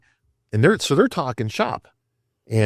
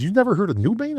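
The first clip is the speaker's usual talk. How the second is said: much higher, mock-astonished question; retold incredulous question; 10 semitones higher than usual. Gym friend to green newcomer about New Bane, mock astonished. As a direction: imitate a friend who can't believe it. Retold surprise at his ignorance.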